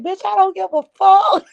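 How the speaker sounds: very high-pitched, hammed-up defiance; mock defiant retort. Co-host retelling Piece's reply to the caller, squealed mock defiance. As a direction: imitate a brazen comeback, voice shooting up. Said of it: "And Piece was like".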